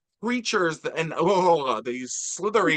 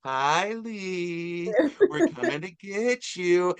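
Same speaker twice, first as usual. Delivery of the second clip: put-on higher, slow, sing-song creepy child voice; creepy twin sisters; 5 semitones higher, much slower than usual. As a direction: taunt like spooky little twins. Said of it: "it's very cliched".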